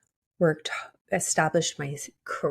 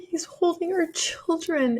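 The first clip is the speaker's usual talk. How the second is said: emotional whisper; 9 semitones higher, slower than usual. Retold or imitated. Retelling vision, emotional whisper.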